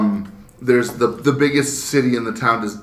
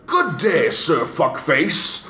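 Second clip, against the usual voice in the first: formal robot butler greeting, higher; polite butler robot; 4 semitones higher, much slower than usual. Retold or imitated. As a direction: announce it like a prim robot butler. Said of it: "my floating robot butler walks up to me, he's like".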